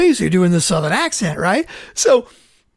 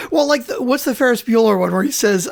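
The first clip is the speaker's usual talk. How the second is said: ordinary talk, nothing marked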